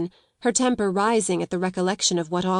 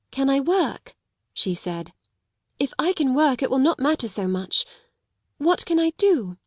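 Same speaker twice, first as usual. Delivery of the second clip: hopeful eagerness; 6 semitones higher than usual. A narrator's reading. Child brightens with relief.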